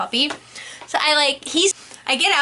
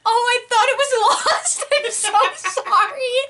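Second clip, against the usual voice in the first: higher, laughing, sheepish sorry; embarrassed laughing apology; 6 semitones higher than usual. Retold or imitated. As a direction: apologize while cracking up. Apologizing to the owner.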